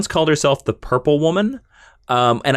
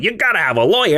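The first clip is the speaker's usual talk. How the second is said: higher, insistent judge's voice; acted judge; 6 semitones higher, faster than usual. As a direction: play an exasperated judge laying down a rule. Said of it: "the judge is like".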